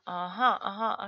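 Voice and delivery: ordinary talk, nothing marked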